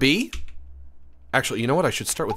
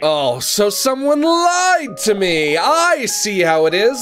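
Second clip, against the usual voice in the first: hammed-up wounded wail, much higher and louder, slow; mock wail; 11 semitones higher, 6 dB louder, slower than usual. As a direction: fake crying at being lied to. Mock betrayal by chat.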